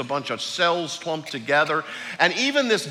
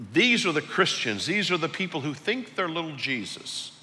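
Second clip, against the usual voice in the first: usual speaking voice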